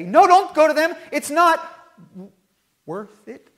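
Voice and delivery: high, loud alarmed plea; pleading parent. Retold or imitated. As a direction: beg your child not to go. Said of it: "you might want to say".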